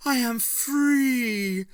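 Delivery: exultant creature shrieking its freedom; triumphant shriek. A narrator's reading. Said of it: "gave a shriek of triumph".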